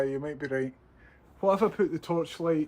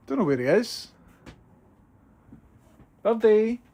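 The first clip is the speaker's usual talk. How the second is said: raised high calling voice; 9 semitones higher, 7 dB louder than usual. Real, not acted. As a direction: call out for the bird. Calling a bird in the loft.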